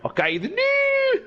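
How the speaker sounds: put-on a deliberately poor Scottish Borders accent; teasing the Scottish Borders girlfriend in her accent; bad Borders accent. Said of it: "I can't do a Borders accent very well".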